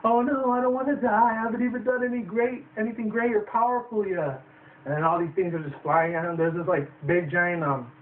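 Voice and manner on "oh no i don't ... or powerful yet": higher, hammed-up fear of dying; mock panic. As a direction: squeal it like a coward. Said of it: "He's like".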